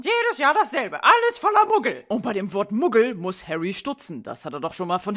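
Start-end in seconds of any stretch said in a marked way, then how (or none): 0.0-2.0 high, loud shrill mother voice; shrill woman voice. Acted. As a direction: squawk it like a fussy mum. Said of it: "die Stimme einer Frau sagen".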